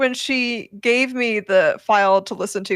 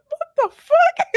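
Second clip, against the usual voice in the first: high, startled what the fuck with a laugh; shocked exclamation. Retold or imitated. Partner hearing the sound-designed file, delighted shock. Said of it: "and she's like".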